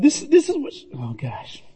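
fighting back tears mid-sentence; real emotion catching in the throat; choked up. Real, not acted. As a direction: voice catching, nearly breaking off. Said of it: "I'm not choked up".

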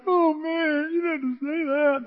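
high, whiny mock sobbing; imitated crying. Brother hurt by blunt words about his divorce, mock crying. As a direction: drag it out in a tearful wail. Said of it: "and he's crying".

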